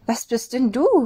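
wide-eyed question in a small high voice; a young rabbit's astonished high question. A narrator's reading. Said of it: "fragte Henry erstaunt".

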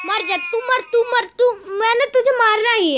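high, loud cursing at the game; frustrated rage. Real, not acted. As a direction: lash out at him in fed-up fury. Rages at the character. (गुस्से में कोसते हुए)

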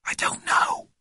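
put-on voiceless boyish whisper; hushed, breath-only reply. A narrator's reading. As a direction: whisper back so nothing outside hears. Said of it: "whispered Jeremy James".

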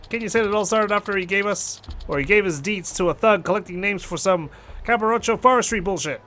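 lightly voiced, exasperated caller; a hint of an annoyed young boss; indignant club manager. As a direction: gripe about the scam. Voices Yuya complaining of the scam.

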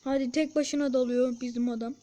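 ordinary delivery

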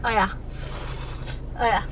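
usual speaking voice

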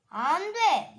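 loud sharp no; refusing shout. Pretend. 장난감 거절하는 인형. (같이 놀자는 친구에게 크게 거절함)

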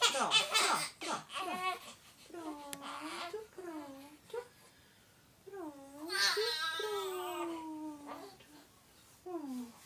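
high, hushed and slow. Real, not acted. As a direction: coo softly to calm a crying newborn. Hushing baby.

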